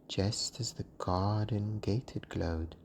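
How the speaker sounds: ordinary delivery, nothing marked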